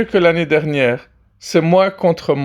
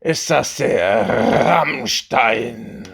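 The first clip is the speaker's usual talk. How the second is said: low slow metal voice; 6 semitones lower, much slower than usual. Acted. [name] voiced low and heavy.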